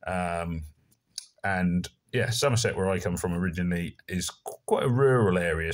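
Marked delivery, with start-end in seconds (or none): none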